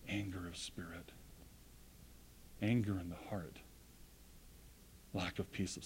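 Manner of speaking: breathy whispered words. Acted. Preacher naming anger to the congregation, whispered. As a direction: whisper it like a grave secret.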